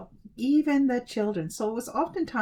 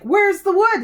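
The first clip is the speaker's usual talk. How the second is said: loud, high-pitched demand; demanding parents; 11 semitones higher, 11 dB louder than usual. A narrator's reading. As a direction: call out sharply for an answer.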